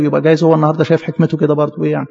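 ordinary delivery

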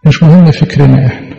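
normal talking voice

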